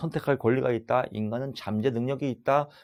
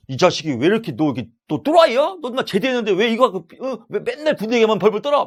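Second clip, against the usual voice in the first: high, loud telling-off; acted harsh rebuke; 10 semitones higher, 9 dB louder than usual. Counselor confronting a client in the hot seat, shouted mock rebuke. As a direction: chew them out in mock fury. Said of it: "면박을 주는 거죠".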